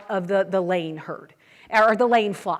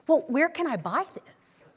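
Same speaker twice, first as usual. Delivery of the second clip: naive question; 5 semitones higher, 3 dB quieter than usual. Retold or imitated. Waitress wants to buy steak.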